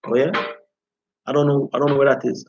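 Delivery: ordinary talk, nothing marked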